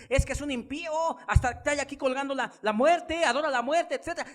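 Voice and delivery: high, loud pulpit voice, driving each point home; impassioned sermon delivery; fiery preaching. Acted. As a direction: preach it to the congregation with full force. Preaches at full force.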